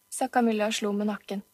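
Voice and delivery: ordinary delivery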